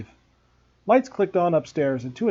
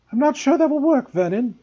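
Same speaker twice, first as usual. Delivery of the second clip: high, doubtful aunt voice, much higher; Aunt Petunia voice; 11 semitones higher, 7 dB louder than usual. A narrator's reading. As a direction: raise the pitch for the worried aunt.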